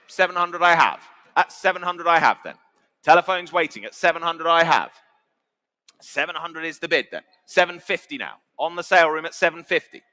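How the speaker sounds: ordinary delivery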